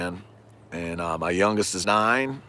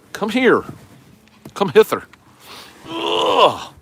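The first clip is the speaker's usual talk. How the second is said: mock coaxing call; 14 semitones higher, 6 dB louder, slower than usual. Coaxing drifting kayak to shore.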